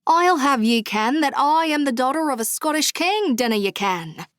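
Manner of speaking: hammed-up Scottish brogue, pitched high; deliberately cheesy Scottish accent, playing a princess; playful mock Scottish brogue; fake Scottish brogue. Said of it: "responded in my awesome brogue".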